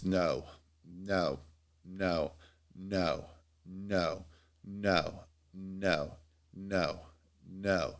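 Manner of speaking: low, soft string of refusals; retold rejections. People turning down a cold caller, quiet repeated refusals. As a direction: repeat each refusal quietly, with a pause between.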